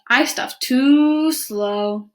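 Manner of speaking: very slow, drawn-out turtle voice. Acted. Turtle refusing to deliver invitations, very slow voice. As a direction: stretch each word out, turtle-lazy.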